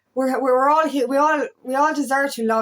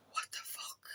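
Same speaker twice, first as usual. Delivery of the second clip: whispered shock; 23 dB quieter than usual. Retold or imitated. Imagined reaction, years ago.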